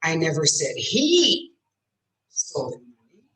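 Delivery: long emphatic "he"; stress on he. Shown as a demonstration. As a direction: punch "he", then drop low for the rest. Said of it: "put stress on a different word".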